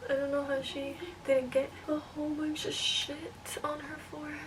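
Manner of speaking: unvoiced, hushed aside to the camera; soft confiding whisper. Real, not acted. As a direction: whisper it as a private remark. Whispered aside while styling.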